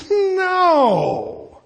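high, stretched, half-laughing "No."; exaggerated no. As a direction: wail the no in mock disbelief. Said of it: "No way, man".

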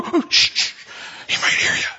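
urgent whisper; frantic hushed whisper. Retold or imitated. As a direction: hiss it so he won't hear. Disciples hushing each other.

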